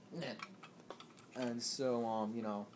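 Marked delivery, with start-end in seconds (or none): 0.1-0.3 short clipped bird noise; penguin squawk. Acted. Penguin taking the thirty dollars, squawking. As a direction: give a brief penguin squawk. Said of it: "and he's like".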